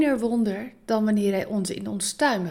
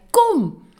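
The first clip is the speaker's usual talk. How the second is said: high, loud single command; commanding call; 10 semitones higher, 10 dB louder than usual. Acted. Jesus calling Peter out of the boat, loud command. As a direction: call him out onto the water. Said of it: "En Jezus zei".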